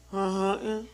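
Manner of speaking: mumbled, wordless carny grunt; garbled carny mumble; carny server. Retold or imitated. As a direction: mimic a server's unintelligible counter talk. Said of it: "same game".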